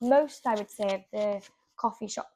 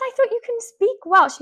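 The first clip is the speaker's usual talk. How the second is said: much higher and louder, amazed; astonished exclaim; 13 semitones higher, 10 dB louder than usual. Retold or imitated. Retelling her shock at a friend speaking Welsh, loud astonished exclaim. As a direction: blurt it out in disbelief.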